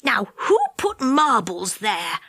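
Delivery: slow, puzzled voice; Moonface wondering. A narrator's reading. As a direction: muse aloud, baffled. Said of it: "he wondered".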